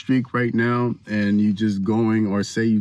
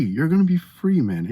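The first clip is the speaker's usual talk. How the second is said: ordinary delivery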